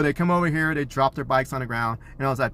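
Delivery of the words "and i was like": ordinary talk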